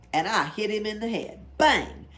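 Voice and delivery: loud excited battle cry; excited bang. Acted. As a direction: shout it like the big hit. Fighting the snake.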